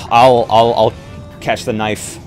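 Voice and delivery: normal talking voice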